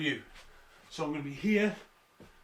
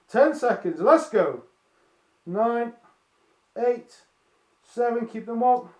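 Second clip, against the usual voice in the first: loud, raised voice under exertion; strained countdown; 5 semitones higher, 7 dB louder, slower than usual. Real, not acted. Coach counting class through final seconds, loud and straining. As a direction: push through the last seconds, higher and louder.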